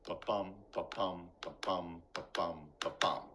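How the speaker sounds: BA-BUM pulsed like a heartbeat, stress on each bum; low, steady ba-BUM, ba-BUM thump; heartbeat rhythm. Shown as a demonstration. Teacher showing iambic pentameter as a heartbeat, rhythmic. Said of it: "same rhythm of your heartbeat".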